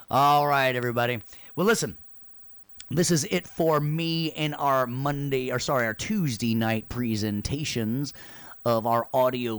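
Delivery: loud, projected show-host farewell; announcer-style sign-off. Acted. Host thanks everyone for stopping by, announcer voice. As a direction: wrap up the show in a big announcer voice. Signs off the class.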